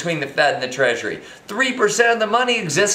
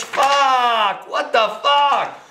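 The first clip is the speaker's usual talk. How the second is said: angry outburst; 6 semitones higher, much slower than usual. Real, not acted. Exploding mid-rant.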